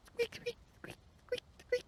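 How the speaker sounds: very high squeaky peeps; tiny chick squeaks. Pretend. Tiny chick squeaking at the player, very high.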